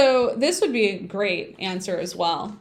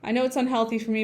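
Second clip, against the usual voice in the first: usual speaking voice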